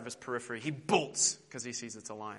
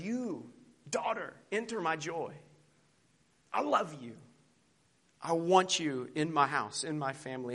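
tender Aslan. Acted. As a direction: welcome her gently, like a loving father. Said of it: "And Aslan says".

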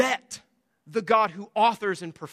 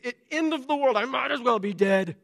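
put-on child's melodramatic tantrum, pitched up; outburst; 4 semitones higher than usual. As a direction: wail it like the world is ending, hammed-up. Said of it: "and then outbursts, exceedingly displeased".

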